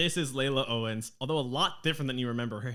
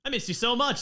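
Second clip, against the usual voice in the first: much higher Australian with fondness; fond Australian dwarf; 5 semitones higher than usual. Acted. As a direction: say it like a hugging old friend. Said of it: "her accent has always been the same".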